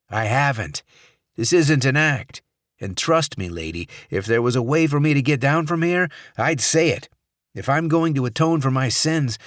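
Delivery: loud, pleading senator; a trapped man arguing for his life; desperate, protesting senator. Acted.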